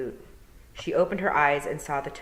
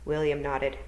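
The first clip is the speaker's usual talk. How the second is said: usual speaking voice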